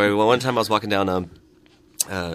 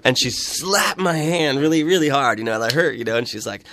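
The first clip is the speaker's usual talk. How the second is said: ordinary talk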